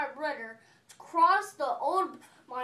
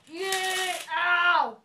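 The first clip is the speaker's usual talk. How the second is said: mock ow; 4 semitones higher, 5 dB louder than usual. Character crashing.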